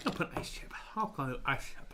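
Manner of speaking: weak, breathy whisper in pain; barely whispered, worn out. Real, not acted. Whispering through the burn.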